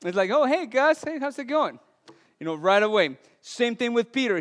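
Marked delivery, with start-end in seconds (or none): 0.3-1.8 cheerful greeting imitation. Imitating callers recognizing pastor.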